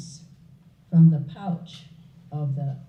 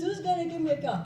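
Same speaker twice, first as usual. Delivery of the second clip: whiny renunciate; 9 semitones higher, 6 dB quieter, faster than usual. Acted. Renunciate whines about cow.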